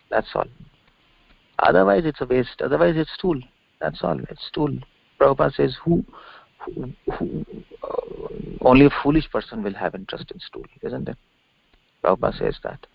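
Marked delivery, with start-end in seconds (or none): none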